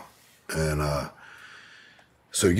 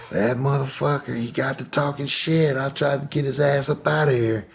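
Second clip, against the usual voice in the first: higher mock-angry aunt; aunt's rant; 11 semitones higher, 3 dB louder than usual. Aunt complaining about her husband, mock-angry and higher. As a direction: huff it out like a fed-up aunt. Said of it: "She was like".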